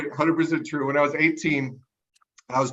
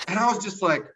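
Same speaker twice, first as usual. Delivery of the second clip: ordinary delivery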